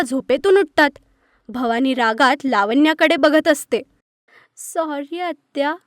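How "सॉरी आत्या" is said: soft, slow, pleading sorry; pitiful apology. Acted. Niece pleading with her aunt, soft and slow. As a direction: beg forgiveness meekly. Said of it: "असं केविलवाण्यासारखं बोलते लावण्या".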